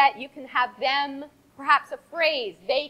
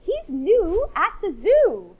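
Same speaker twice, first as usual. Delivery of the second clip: giraffe voice; 5 semitones higher, 4 dB louder, slower than usual. Acted.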